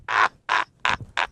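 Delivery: breathy laugh. Real, not acted. Laugh after joke.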